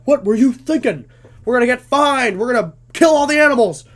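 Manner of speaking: loud, high mock outrage; angry scolding troop leader. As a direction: berate the boys in an acted panic.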